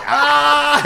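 high, loud laughing "ah"; sudden squealed laugh, retold; imitated burst of laughter. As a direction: mimic someone bursting out laughing. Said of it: "she just starts laughing".

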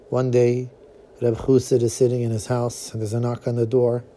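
ordinary delivery

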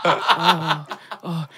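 drawn-out fake moan of pleasure; mock moaning. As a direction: imitate a lover moaning, hammed-up. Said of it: "She's sort of moaning a little bit".

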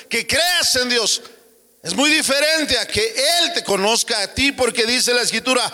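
high, ringing pulpit cry; full-force preaching. Acted. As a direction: preach it out to the congregation. (predicación a todo pulmón)

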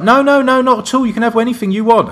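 high, gushing imitation of the hostess; lightly mocking a keen hostess; eager hostess. Eager hostess offering drinks.

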